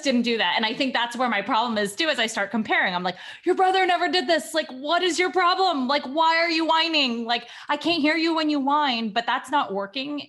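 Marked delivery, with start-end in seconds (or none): 3.4-9.1 exasperated scolding. Retold or imitated. Mom scolding whining daughter.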